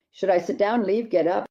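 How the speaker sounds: ordinary talk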